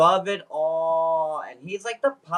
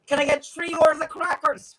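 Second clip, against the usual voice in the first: put-on Irish accent; 10 semitones higher, 3 dB louder, faster than usual. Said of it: "And then there's the Shayna".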